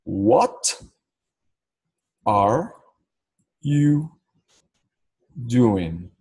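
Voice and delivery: "What are you doing?" one word at a time, long pauses; pergunta pausada. Shown as a demonstration. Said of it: "Mas como é uma pergunta". ("What are you doing?" palavra por palavra, bem pausado)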